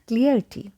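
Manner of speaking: clarity as CLEAR-tee; mispronunciation. Shown as a demonstration. Teacher rejects the form from clear, mispronounced clarity. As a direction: begin "clarity" like the word clear. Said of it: "it's not".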